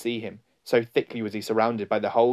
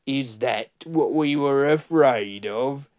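slow, raised creature voice; mocking follower, slow and higher; jeering; 4 semitones higher, 4 dB louder, slower than usual. A narrator's reading. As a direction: drag the question out scornfully. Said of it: "jeering at him".

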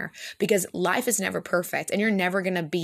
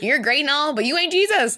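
higher and louder, a playful jab; playful teasing; 8 semitones higher, 5 dB louder than usual. Real, not acted. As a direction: tease your friend, rising to the punchline. Teasing her co-host Jenny.

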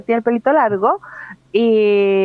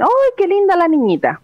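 gushing stranger; 8 semitones higher than usual. Retold or imitated. Stranger praising the long-haired boy as a girl, high gushing. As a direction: coo like a passerby admiring a baby. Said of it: "me dicen".

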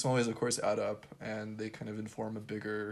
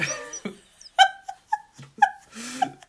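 crying laughter; 10 dB louder than usual. Real, not acted. Laughing fit after Old English.

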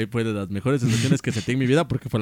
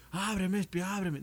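pleading at door; 7 semitones higher, 8 dB quieter, slower than usual. Retold or imitated. Calling through a locked door.

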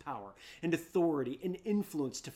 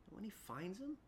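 normal talking voice

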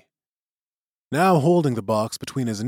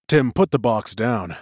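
ordinary delivery

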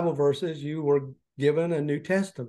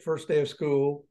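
normal talking voice

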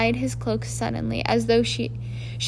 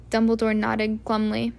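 plain reading voice

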